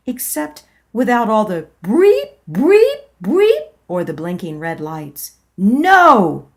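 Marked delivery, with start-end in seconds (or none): 1.8-3.7 rising, much higher beep sounds; alarm beeps. Retold or imitated. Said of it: "Red Alert from the Star Trek series". 5.6-6.5 sharp, loud no; loud refusal. Acted. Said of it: "I said".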